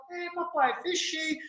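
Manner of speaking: put-on small child's voice. Son Mateus telling his father he finished the square, small high child voice. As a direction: answer like a little boy pleased with his drawing.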